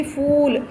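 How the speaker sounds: फूल with a long, sustained oo; deep long vowel. Shown as a demonstration. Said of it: "deep जाके बोलेंगे".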